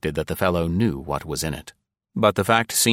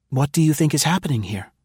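put-on fast, higher anxious young woman; swift question; 8 semitones higher, faster than usual. A narrator's reading. Nancy asking Val what happened, swift and anxious. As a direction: rattle the question off quickly. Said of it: "Nancy asked Val swiftly".

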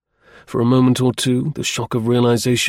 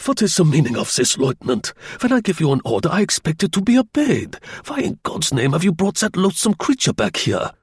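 livid German general, pitched up; German-accented querulous rage, rising higher; 7 semitones higher than usual. Acted. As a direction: scold the lieutenant in a peevish high voice. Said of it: "his voice high-pitched and querulous".

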